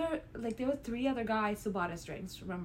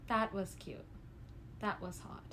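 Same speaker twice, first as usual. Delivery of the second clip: smitten aside; 3 semitones lower, 10 dB quieter than usual. Retold or imitated.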